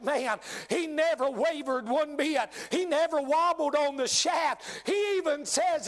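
ordinary talk, nothing marked

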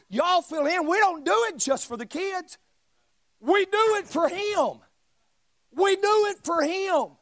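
forceful, loud-peaking sermon delivery; preaching. Real, not acted. Preacher pressing the congregation about hurt and storms, forceful preaching. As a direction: preach it hard, punching key words.

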